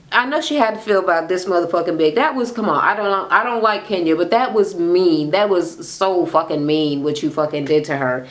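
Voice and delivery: usual speaking voice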